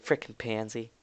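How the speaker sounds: very soft, low aside; hushed parting insult; muttered whisper. Acted. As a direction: say it under your breath. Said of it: "as she whispers".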